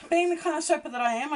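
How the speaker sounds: ordinary talk, nothing marked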